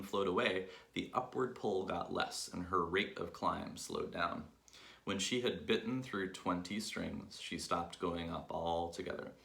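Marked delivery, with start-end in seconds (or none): none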